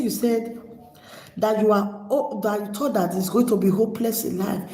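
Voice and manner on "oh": ordinary delivery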